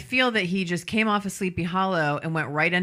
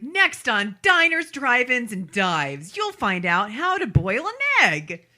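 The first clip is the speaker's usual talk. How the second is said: high-starting, drawn-out TV host promo; big food-show announcer impression; Guy Fieri; 6 semitones higher, 4 dB louder, slower than usual. Friend shows how a food-show host talks, Guy Fieri impression. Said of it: "You sound like Guy Fieri".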